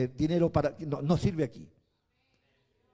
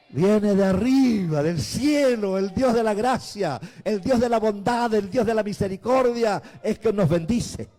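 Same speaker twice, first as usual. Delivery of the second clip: louder shouted praise, rising to a climax; preaching at full force; 2 semitones higher, 10 dB louder than usual. Acted. Pastor lifting praise to the congregation, loud shouted preaching. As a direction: proclaim it loud to the whole church.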